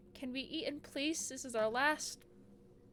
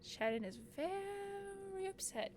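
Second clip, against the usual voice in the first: soft; 7 semitones higher, 8 dB quieter than usual. Acted. Narrating the quarrel scene.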